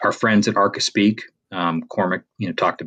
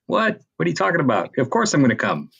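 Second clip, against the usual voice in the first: high, surprised disbelief; leaping up in pitch, amazed at the question; mock astonished "what?"; incredulous reply; 9 semitones higher, faster than usual. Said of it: "it was like".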